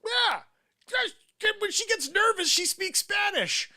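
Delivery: high, slow mocking squeak. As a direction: sneer it in a squeaky voice. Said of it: "you say".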